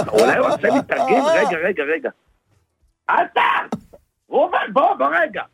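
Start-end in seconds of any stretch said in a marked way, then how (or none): 3.1-5.4 high-pitched yell to someone across the shop; raised, high calling voice. Acted. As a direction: holler for him to come over. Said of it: "למה אתה צועק?".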